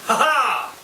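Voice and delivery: high, loud gloating laugh; triumphant laugh. Acted. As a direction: cackle at the enemy. Soldiers flanking in battle.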